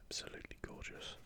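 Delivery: normal talking voice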